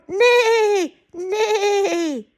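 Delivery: long, high wavering neighs; whinny proudly like a horse; horse neighing. Acted. Horse shows its sound, neighing. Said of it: "what sound do you make?".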